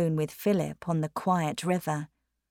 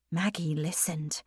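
plain reading voice

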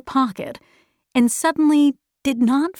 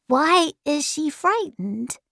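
put-on slow, higher small-boy voice; lightly voiced puzzled boy; 5 semitones higher, slower than usual. A narrator's reading. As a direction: ask it like a wondering child. Charles Wallace voiced in story.

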